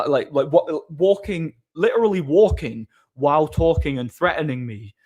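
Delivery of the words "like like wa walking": ordinary delivery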